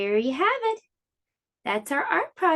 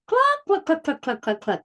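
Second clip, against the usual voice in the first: clucks that start much higher and fade down; laughing hen clucks; 4 semitones higher, faster than usual. Acted. Mother hen laughing at Hedgie, higher clucking that fades. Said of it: "she laughed".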